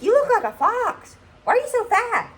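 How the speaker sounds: squeaky high-pitched drugged teasing; loopy high mock voice. Drugged patient teasing her friend, giddy high squeak.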